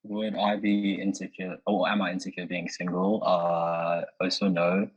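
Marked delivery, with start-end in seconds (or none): none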